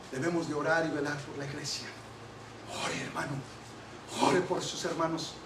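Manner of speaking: very soft, low sermon voice, sinking at phrase ends; hushed, earnest preaching. Real, not acted. Preacher urging brothers to guard the church, hushed earnest preaching. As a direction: drop almost to a murmur, pausing between warnings.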